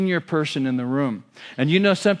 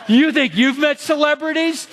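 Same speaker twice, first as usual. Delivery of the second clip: higher and louder, scoffing braggart; mock one-upper; 10 semitones higher, 3 dB louder than usual. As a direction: play the boastful one-upper, laughing at them. Mocking one-upping salespeople.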